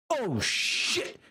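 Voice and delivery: high-pitched, alarmed sergeant voice; panicked sergeant. Acted. Acts a panicked sergeant under fire.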